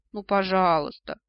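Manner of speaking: imploring, stretching out the word; pleading. A narrator's reading. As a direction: beg like a child coaxing a grandparent. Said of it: "взмолилась я".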